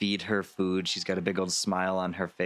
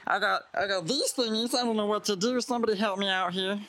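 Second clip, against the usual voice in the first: much higher, relieved rush; panicked real self; 12 semitones higher than usual. Acted. Disguised figure confessing to Lance once the door shuts, much higher. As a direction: drop the act in frantic relief. Disguised character begging for help.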